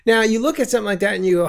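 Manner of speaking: ordinary talk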